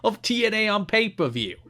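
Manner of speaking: high, loud cartoon New Jersey accent, cracking into laughs. Reading a fan's letter to Jarrett in a New Jersey accent. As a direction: read the fan letter like Joey Wheeler. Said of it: "read this in a New Jersey accent".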